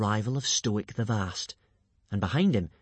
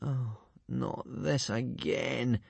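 very slow, soft, weary groan; 6 dB quieter, much slower than usual.

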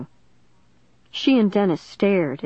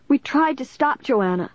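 higher, upset pleading tone; tearful; 8 semitones higher than usual. A narrator's reading. Caitlin explaining to the police officer, tearful. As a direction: plead through tears. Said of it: "wiping her tears off her cheeks".

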